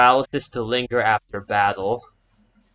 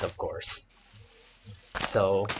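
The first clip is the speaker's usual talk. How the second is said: ordinary talk, nothing marked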